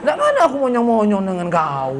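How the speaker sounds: exaggerated high-pitched denial, then dropping; mimicked rebuffing girl. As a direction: play her brushing off the suitor. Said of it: "kata dia".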